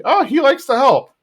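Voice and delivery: high, prim Peggy imitation; mock Peggy voice. Peggy defending Bobby to Bill, in a high mock voice. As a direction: play it as Peggy brushing off the concern.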